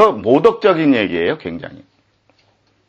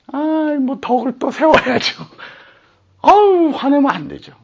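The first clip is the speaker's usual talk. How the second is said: high, simpering imitation of holy talk; mock pious voice; 10 semitones higher than usual. Preacher parodying believers who say anger is wrong, in a mock pious voice. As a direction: sneer at fake gentleness.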